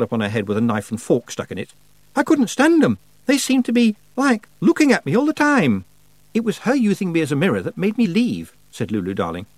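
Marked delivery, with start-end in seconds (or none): 2.2-5.8 put-on much higher, louder girl's complaint; Lucy voiced. Lucy complaining about skulls. 6.3-8.5 put-on higher girl's voice; a touch of an aggrieved girl; Lulu voiced. Lulu's complaint.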